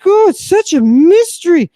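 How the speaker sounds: squealing fake amazement at the riddle; mocking awe. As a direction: parody gushing tourists, way up high. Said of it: "they're like".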